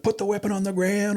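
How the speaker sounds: high, urgent retold order; military police command. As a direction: imitate commanding someone to disarm. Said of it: "went into M P mode".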